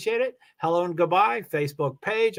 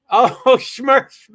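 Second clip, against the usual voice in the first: loud, higher, laughing mock anger; mock angry; 7 semitones higher, 9 dB louder than usual. Shown as a demonstration. Streamer tries a viewer's angry coaching on a word, mock angry. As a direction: play at being angry, laughing. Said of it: "say it like you're angry".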